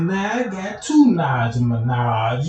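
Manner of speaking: usual speaking voice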